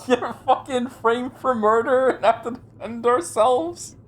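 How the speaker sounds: high-pitched, incredulous outburst; loud exasperated disbelief. Real, not acted. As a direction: cry out in baffled protest. Outraged reaction to being framed again.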